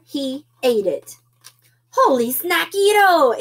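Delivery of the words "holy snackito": high, loud delighted exclamation; excited storybook exclamation; exclaiming. A narrator's reading. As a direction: burst out like a thrilled kitten. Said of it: "exclaimed the kitty boy".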